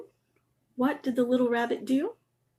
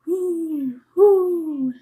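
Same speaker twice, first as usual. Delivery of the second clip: high, loud, falling owl hoots; 7 semitones higher, 9 dB louder than usual. Acted. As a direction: hoot like a fat old owl. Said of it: "hooted the owl".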